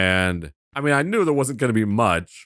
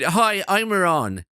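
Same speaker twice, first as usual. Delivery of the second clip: slow, higher doll voice in an Irish accent; a little Irish lad doll voice, slow and higher; 6 semitones higher, slower than usual. Shown as a demonstration. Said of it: "he should have an accent".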